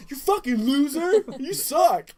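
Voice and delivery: high, loud, drawn-out jeer; taunting yell. Acted. Retelling mocking beaten little brother, loud jeering yell. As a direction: jeer at him at full voice.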